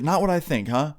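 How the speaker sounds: high, challenging mom retort; sceptical mom. Retold or imitated. Mom answering older son's excuse, sceptical mom. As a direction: throw it back like an unconvinced mom.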